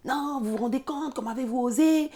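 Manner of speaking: indignation jouée, voix plus haute; la responsable outrée la met dehors; imitation de colère. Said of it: "Et elle rentre dans une colère noire". (colère imitée, voix plus aiguë)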